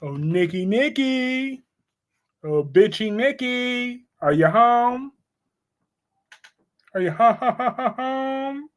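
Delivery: high, slow drawn-out call with stuttered home; mock Victor calling. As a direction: tease her name in a long sing-song. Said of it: "what Victor will say".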